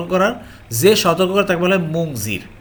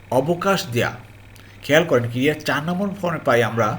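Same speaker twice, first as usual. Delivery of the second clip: usual speaking voice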